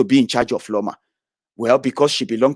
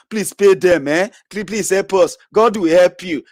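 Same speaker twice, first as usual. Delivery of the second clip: mocking beggar; 5 semitones higher than usual. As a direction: ham up a grovelling plea to the governor. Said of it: "you want me to come here, and say".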